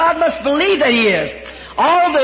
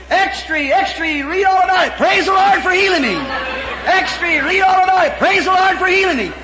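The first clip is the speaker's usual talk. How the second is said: newsboy shout; 4 semitones higher than usual. Retold or imitated. Healed old man selling papers on the street, joyful shout. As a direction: call the papers out with joy.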